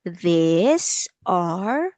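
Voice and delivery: slow, stretched words, each lifting at the end; word by word, leaving room to repeat; slow teacher's model for a learner; said slowly. Shown as a demonstration. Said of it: "Let's say it slowly".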